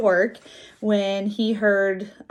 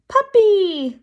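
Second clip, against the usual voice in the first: put-on high, loud child's voice; very high toddler exclamation; excited little kid; 12 semitones higher, 7 dB louder than usual. A narrator's reading. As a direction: squeal it out like a delighted small child. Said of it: "the kid said".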